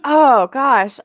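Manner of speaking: ordinary talk, nothing marked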